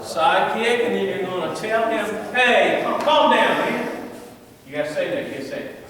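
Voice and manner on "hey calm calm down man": loud, raised, firm command; like you mean it. Shown as a demonstration. Coach models what to tell the attacker, loud and firm. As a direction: order an attacker to settle down. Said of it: "Say it like you mean it".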